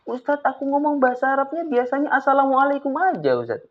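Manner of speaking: high, lightly voiced pupil; a hint of a whiny kid; student complaint. Teacher playing a pupil who only says salam.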